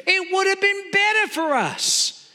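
high-pitched mock grievance; acted complaint, pitched well up; complaining. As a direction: wail it lightly like a griping crowd. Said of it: "listen to the complaints".

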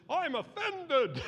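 put-on high, slow whiny child voice; mock offended little boy. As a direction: pout it out like a sulky kid.

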